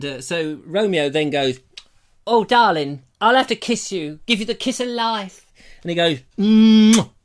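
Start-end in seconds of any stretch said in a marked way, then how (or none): 2.3-5.5 louder, hammed stage lover; thespian Romeo. Romeo about to kiss Juliet, declaiming like a ham. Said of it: "in thespian language". 6.4-7.1 loud exaggerated mwah; big loud smacking kiss. Romeo kissing Juliet, a big loud smack. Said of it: "like that, great big smacker thing".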